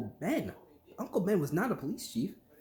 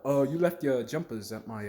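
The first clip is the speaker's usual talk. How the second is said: lightly put-on British accent; casual British voice, a hint only; 5 semitones lower than usual. Fancy British stranger mentions a sleepover, light British accent. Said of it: "he's British, he's got an accent".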